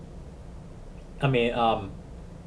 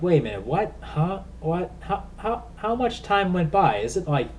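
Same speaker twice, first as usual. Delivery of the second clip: confused; 6 semitones higher than usual. Retold or imitated. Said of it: "I'm like".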